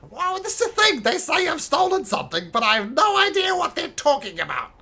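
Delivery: high, loud goblin whining innocence; protesting goblin. Acted. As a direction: plead ignorance, goblin-style. Goblin explains accusation.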